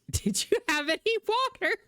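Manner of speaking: high-pitched, put-on questioning voice; mock concerned question. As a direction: pitch it way up, imitating a stock reply. Mimics own stock reply to husband.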